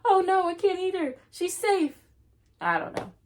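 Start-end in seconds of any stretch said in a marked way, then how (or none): 0.1-2.0 high, loud, fast scared monster; silly monster voice. Pretend. Reader voices the monster who won't eat her, high and loud. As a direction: squeal it like a startled monster. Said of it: "and he says".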